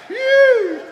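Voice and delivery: loud, high-pitched whoo; high whoop. Real, not acted. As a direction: let out one long high whoop. Playful whoop after teasing the congregation.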